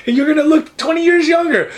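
high-pitched, sassy sales patter; hyped-up hairdresser. Acted. Hairdresser promises client a younger look, hyped.

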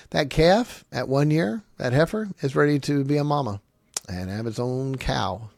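usual speaking voice

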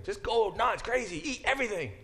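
high, fast, exuberant outburst; emphatic urging. Shown as a demonstration. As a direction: urge them on with wild abandon. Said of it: "It's a strong emphasis".

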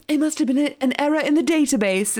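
lightly voiced sketchy official, pitched high; sketchy lady. Sketchy lady excusing a database mix-up, lightly put-on sketchy voice. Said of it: "sketchy lady is like".

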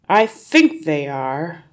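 loud, slow, drawn-out answer; reluctant father. A narrator's reading. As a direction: agree slowly, in no hurry to move. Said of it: "the father said, sitting tight".

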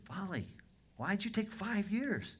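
high, slow, incredulous quote; exasperated question. Retold or imitated. Pastor asking the convert about his delay, mock exasperated. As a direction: draw out the question, pitched well up.

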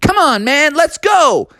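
high, eager rallying cry; Sonic. Acted. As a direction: urge a buddy onward. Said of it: "And Sonic was like".

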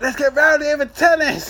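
laughing mock rowdiness. Joking about rowdy tennis crowds.

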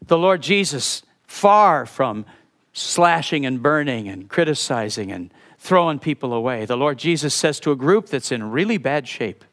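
ordinary talk, nothing marked

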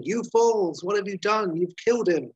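high-pitched mock outraged cry; hammed-up shout of accusation, pitched high; retold shout. Recapper voices McGee held back by soldiers, mock shouting. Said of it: "McGee shouting".

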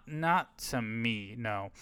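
usual speaking voice

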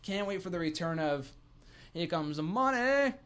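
ordinary delivery

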